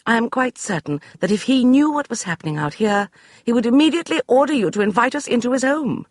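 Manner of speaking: high, brisk dismissal; imperious lady; commanding lady. Acted. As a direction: brush him aside like a general. Beatrice voiced.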